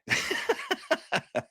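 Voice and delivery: high cartoon dog laugh; very high wheezy giggle; Scooby-Doo laugh. Shown as a demonstration. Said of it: "just love doing it".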